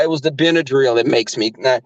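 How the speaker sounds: ordinary talk, nothing marked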